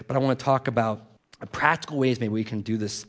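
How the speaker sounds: usual speaking voice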